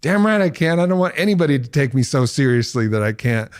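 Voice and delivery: lightly voiced defiant Joe. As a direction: brush off the objection with swagger.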